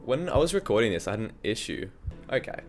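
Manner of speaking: normal talking voice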